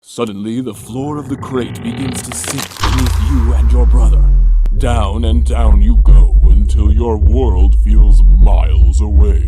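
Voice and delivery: loud echoing doom narration; booming dramatic narration. Acted. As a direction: boom it like a movie trailer.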